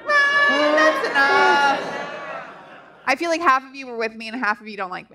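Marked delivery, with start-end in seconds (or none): 0.0-2.0 high Run, then a slow that's enough; throwaway delivery. Shown as a demonstration. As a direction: toss it off, drawn-out. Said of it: "maybe throw it away more".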